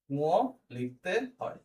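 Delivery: উঁ, লিখতে, হয় drawn out and separated by pauses; slow dictation. Shown as a demonstration. Teacher repeating the rule for note-taking, slow dictation.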